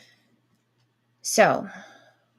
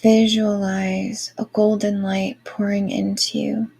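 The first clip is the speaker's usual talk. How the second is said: airy breathy mystical healer. Acted. Guided Reiki meditation.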